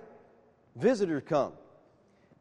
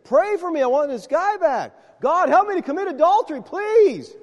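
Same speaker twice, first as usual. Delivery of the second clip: much higher and louder, a mock whiny plea; hammed-up begging from the upset caller; pleading caller; 10 semitones higher, 6 dB louder than usual. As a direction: counselor mocking the caller's prayer request, pleading. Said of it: "she's serious".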